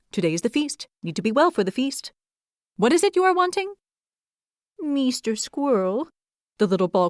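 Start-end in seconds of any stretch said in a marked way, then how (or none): 0.1-3.7 brisk, jumpy questions, some lines much higher; quick, determined squirrel. A narrator's reading. Busy squirrel fires questions at the curled hedgehog, brisk and jumpy. 4.8-6.1 higher, timid voice curled into a ball; shy hedgehog. A narrator's reading. Curled-up hedgehog asks the squirrel a favor, shy and small.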